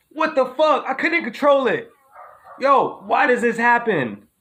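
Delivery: can't believe it happened again; loud, high-pitched bewildered protest; baffled disbelief. Real, not acted. Baffled by contagious yawn.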